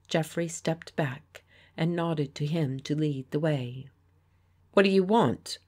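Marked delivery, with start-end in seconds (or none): 4.7-5.6 blunt, louder demand; surly demanding question. Acted. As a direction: confront the strangers. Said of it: "Shean demanded".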